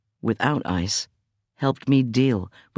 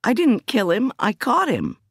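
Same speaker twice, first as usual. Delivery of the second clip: higher, emphatic denial; upset protest; 12 semitones higher than usual. Acted. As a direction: defend yourself hotly. Defending herself to the cop.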